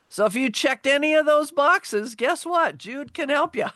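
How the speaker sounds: high, punchy, upbeat sell; playful sales pitch. Real, not acted. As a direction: pitch it high and loud like a gleeful promise. Playful sales pitch after symptom list.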